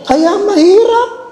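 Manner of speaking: mock lament. Priest whines to the congregation about being a priest.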